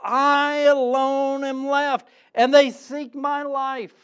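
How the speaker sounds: slow, high, loud lament; despairing Elijah. Acted. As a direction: cry out like a hunted prophet. Preacher voicing despairing Elijah.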